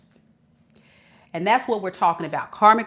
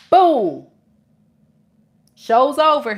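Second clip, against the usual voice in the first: emphatic reveal; 5 semitones higher, 8 dB louder, slower than usual. Real, not acted. Dramatic reveal, loud.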